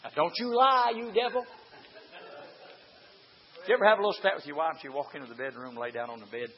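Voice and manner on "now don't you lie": ordinary talk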